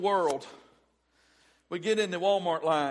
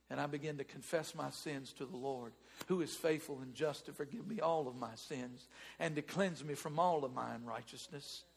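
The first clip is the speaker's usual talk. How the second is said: ordinary talk, nothing marked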